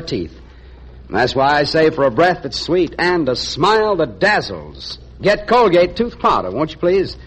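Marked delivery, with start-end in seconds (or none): none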